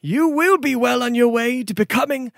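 high, courtly gift speech; formal noble lord. Acted. Noble gives the hero a ring, courtly. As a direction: bestow the ring with ceremony.

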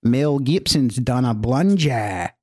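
slow, drawn-out mock Aussie accent; put-on Australian accent, read out deliberately. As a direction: stretch the Australian vowels slowly. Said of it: "in your best Australian accent".

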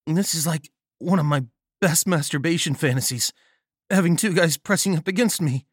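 plain reading voice

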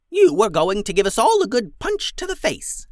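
high, loud, scornful goblin voice; a shrill-sounding old goblin mocking them. Goblin leader mocks the girls' plan, high and scornful. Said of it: "said the goblin".

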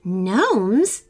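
understated gasp of surprise; boy's mild astonishment to himself; gasped question. A narrator's reading. Timmy overhearing the two little men, gasped. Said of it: "Timmy gasped to himself".

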